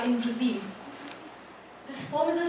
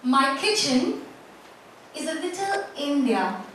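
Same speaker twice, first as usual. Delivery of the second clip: poem recitation. Acted. Poet reciting own poem to a festival audience, measured recitation. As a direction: recite it phrase by phrase, letting each image land.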